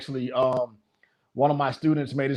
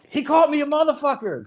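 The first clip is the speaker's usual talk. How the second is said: indignant fighter; 12 semitones higher than usual. Retold or imitated. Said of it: "he was like".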